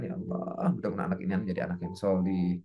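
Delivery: hushed, low supplication; very soft, low blessing; parent's prayer. Shown as a demonstration. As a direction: breathe a soft, private prayer for your child. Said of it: "sambil kita doakan".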